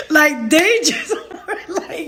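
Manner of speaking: slow down as the giggles take over; real cracking up; laughing retelling. Real, not acted. Cracks up retelling coworkers' reaction.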